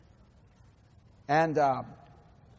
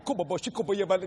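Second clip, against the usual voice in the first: praying in tongues; faster than usual. Retold or imitated. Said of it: "just praying in tongues".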